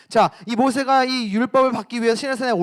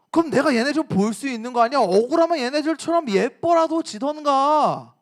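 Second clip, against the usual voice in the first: put-on angry boyfriend; 3 semitones higher than usual. Boyfriend defending himself to girlfriend, indignantly angry. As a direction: play a boyfriend throwing the blame back. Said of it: "라면서 화를 내면".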